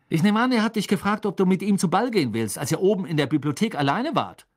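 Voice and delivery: high, fast and accusing; jealous insinuation. Acted. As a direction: rattle off the suspicion at a friend. Ron's jealous questioning of Hermine.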